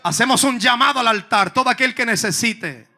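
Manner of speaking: loud, high climactic sermon voice; full-force preaching. Acted. Preacher calls people to the altar, shouting high. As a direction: build the altar call with shouting.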